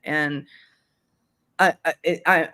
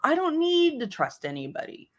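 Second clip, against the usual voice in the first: higher, shrugging don't-care tone; breezy dismissive attitude; 7 semitones higher than usual. Retold or imitated. Younger self shrugging off trusting people, lightly dismissive. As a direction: brush off the need to trust anyone. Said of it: "I had that attitude".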